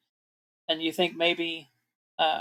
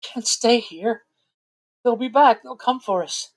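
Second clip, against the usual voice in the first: frightened woman; 6 semitones higher than usual. Acted. GM voices frightened mother NPC.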